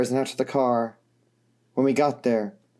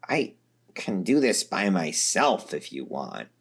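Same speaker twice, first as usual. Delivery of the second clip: low, slow voice for Vriska; 7 semitones lower, 4 dB quieter, slower than usual. A narrator's reading. Vriska, after a heavy breath, low and slow. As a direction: lower and slower, steadying herself. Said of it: "she said".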